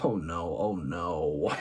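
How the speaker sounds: deep, soft "Oh, no" groan; catchphrase groan, low and soft; low dismayed groan. Retold or imitated. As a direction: drop low and quiet in dismay. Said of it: "thinking to myself, shaking my head".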